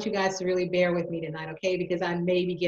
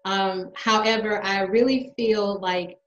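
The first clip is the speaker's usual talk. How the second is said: usual speaking voice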